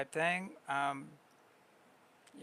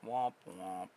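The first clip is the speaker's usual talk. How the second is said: womp womp; 9 semitones lower, 8 dB quieter than usual. Pretend. Sad trombone imitation.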